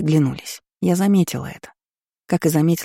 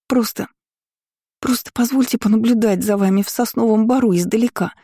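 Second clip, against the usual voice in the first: pleading maid; 4 semitones higher than usual. A narrator's reading. Lili begs on her knees.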